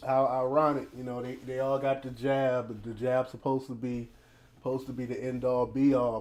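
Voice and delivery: ordinary talk, nothing marked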